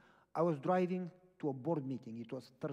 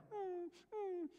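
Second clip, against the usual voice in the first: high thin whimper imitating a crying puppy; dog whimper; 5 dB quieter than usual. Pastor imitates his dog whining at the door. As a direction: whine like a lonely dog at the door.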